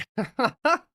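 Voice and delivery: high, loud burst of laughter; cracking up at the friend. Real, not acted. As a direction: laugh hard at your own joke. Laughs after teasing friend.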